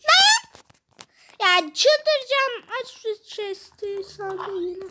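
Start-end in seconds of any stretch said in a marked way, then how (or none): 0.1-0.6 high, loud outraged yell; frustrated shout. Real, not acted. Gamer raging that others found the key at once, furious shout. 1.4-2.7 exasperated. Real, not acted. Frustration over the puzzle.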